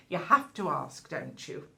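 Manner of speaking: ordinary talk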